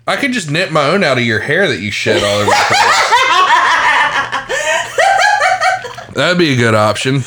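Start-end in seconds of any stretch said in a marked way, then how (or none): 3.2-6.1 high laugh. Real, not acted. Host cracks up at his own joke, laughing high.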